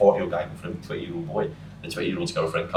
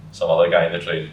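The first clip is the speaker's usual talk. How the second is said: ordinary talk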